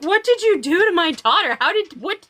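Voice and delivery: high, sputtering mock-dad questions; acted-out confused outrage; bewildered dad. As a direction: imitate a baffled father demanding answers. Dad bursts in, retold.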